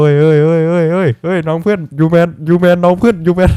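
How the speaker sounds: loud, high panicked shouts of alarm; panicked yelling. Real, not acted. Gamer startled by a monster, panicked yelling. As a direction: yell in real fright, over and over. Jump scare in horror game.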